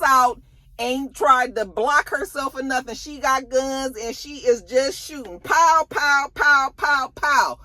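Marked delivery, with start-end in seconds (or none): 5.4-7.6 loud, punchy gunfire noises; imitated gunshots. She got guns and is shooting, loud imitated gunfire. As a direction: fire off each pow like a shot.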